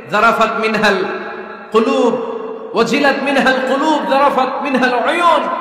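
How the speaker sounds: rising to an impassioned shout; emotional oration. Acted. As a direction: preach it with swelling emotion. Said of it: "আবেগী ভাষণ দিতেন". (আবেগী ভাষণ, উঁচু গলায়)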